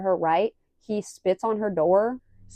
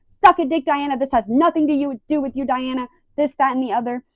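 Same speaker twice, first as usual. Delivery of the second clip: raised, rapid-fire mock rant; going nuts; 5 semitones higher, 4 dB louder than usual. Vlogger repeating Kristen's tirade at the manager, mock fury. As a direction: burst in loud and high, imitating an irate employee. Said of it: "Kristen goes nuts on Diana".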